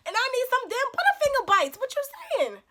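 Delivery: high, loud fed-up comeback; exasperated retort. Acted. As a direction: fire back over his whining, loudly. Snaps back at whining date.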